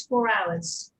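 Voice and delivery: usual speaking voice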